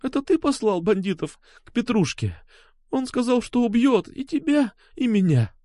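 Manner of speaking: high, fragile tearful woman's voice. A narrator's reading. Алёна tells Сергей of the threat, tearful. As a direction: plead while swallowing tears. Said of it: "глотая слёзы".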